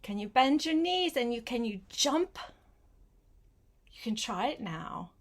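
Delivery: usual speaking voice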